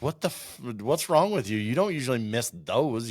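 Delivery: high, exasperated dog talking. Retold or imitated. Dog scolds hunter who missed, high and exasperated. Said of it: "they look at you like".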